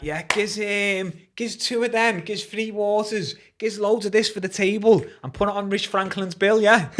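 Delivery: cocky ordering. Retold or imitated. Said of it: "And I'm like, I'm like".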